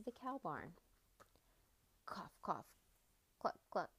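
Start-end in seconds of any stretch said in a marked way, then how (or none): 2.1-2.7 whispered cough. A narrator's reading. Rooster coughing, reading.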